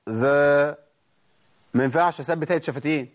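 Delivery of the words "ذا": ذا said with slightly spread lips; ذا with smile. Shown as a demonstration. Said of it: "شوفوا كده".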